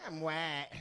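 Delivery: Southern-accented imitation; mock Southern accent. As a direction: say it like you're from the South. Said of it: "like you're from the South".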